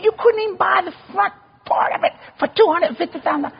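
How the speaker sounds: high-pitched comic scoffing; scoffing disbelief. Retold or imitated. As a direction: scoff at her offer in a squeaky high voice. Husband scoffs at wife's offer.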